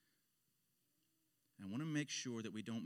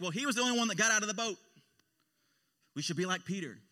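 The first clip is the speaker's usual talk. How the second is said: quick, louder, higher mock enthusiasm; eager admiration; 7 semitones higher, 8 dB louder, faster than usual. Churchgoers talking up Peter for leaving the boat, eager admiration.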